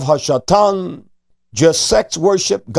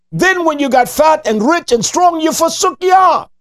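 preacher's shout; 11 semitones higher than usual. Acted. Preacher rebuking Israel for forsaking Yah, shouted. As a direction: cry it out at the height of the sermon.

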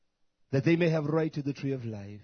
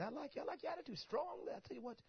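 mock sly boss; 12 semitones higher, 14 dB quieter than usual. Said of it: "boss answering the worker".

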